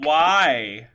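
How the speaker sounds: loud, drawn-out mock disbelief; incredulous retort. Woman retold reacting to a stranger's dinner invitation, loud and incredulous. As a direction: hammer out a disbelieving question.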